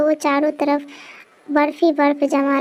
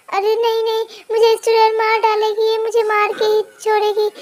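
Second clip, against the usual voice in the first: high frightened plea; high panicked pleading; panicked wife; 6 semitones higher than usual. Acted. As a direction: beg in terror. Rama panics.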